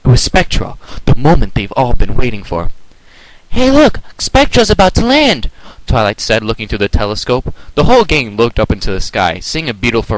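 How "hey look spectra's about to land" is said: high, loud thrilled call; excited. A narrator's reading. Twilight spotting Spectra, high loud call. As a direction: call everyone over.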